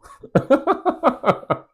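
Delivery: a burst of high, loud laughter. Real, not acted. Laughing out loud at a girlfriend's cheeky confession.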